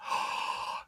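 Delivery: soft unvoiced gasp; mock gasp. At not knowing the answer, a mock gasp. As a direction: fake a shocked intake of breath.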